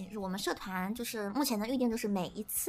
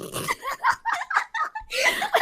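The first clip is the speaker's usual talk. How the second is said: high helpless laughter; laughing fit; 6 dB louder than usual. Real, not acted. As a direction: burst out laughing at the surprise. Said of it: "怎么是个男的".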